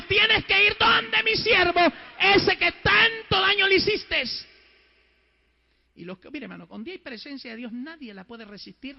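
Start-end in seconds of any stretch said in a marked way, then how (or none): 0.0-4.2 high, loud, slow shout; preacher thundering God's command; retold divine command. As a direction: order it with drawn-out force.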